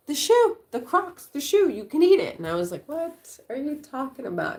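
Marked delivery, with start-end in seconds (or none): 0.1-2.4 put-on high, loud kid voice; excited child voice. 2.9-4.6 incredulous. Retold or imitated. Mom's incredulous reply.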